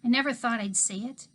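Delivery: ordinary delivery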